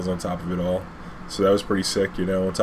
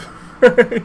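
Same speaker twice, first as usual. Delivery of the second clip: sudden high loud laugh; a real high laugh breaking out; burst of laughter; 8 dB louder than usual. Real, not acted. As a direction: laugh out at the irony. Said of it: "It's like his wife didn't forget".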